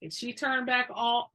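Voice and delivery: usual speaking voice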